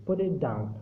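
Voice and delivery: down said with a clear fall; falling intonation. Shown as a demonstration. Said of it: "वो कम हो जाती है, यानी डाउन". (down पर नीचे गिरती पिच)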